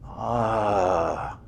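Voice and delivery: long, slow, soft groaning ah; drawn-out quiet groan; uneasy sigh. Retold or imitated. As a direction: let out the discomfort of sitting still. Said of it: "it's like".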